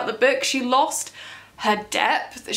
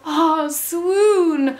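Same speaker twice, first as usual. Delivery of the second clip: mock swoon; 5 semitones higher, 3 dB louder than usual. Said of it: "and then we go".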